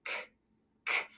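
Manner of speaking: K as a bare voiceless click, no vowel after; short puff of K from the back of the throat; letter sound. Shown as a demonstration. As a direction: isolate the crisp K sound, one at a time. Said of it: "what sound do we hear at the beginning".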